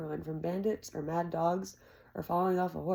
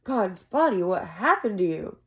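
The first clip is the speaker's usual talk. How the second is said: louder alarmed cry; shocked exclamation; 2 semitones higher, 7 dB louder than usual. Acted. Jake shocked seeing the wounded Carter, louder alarm. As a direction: rush forward in shock.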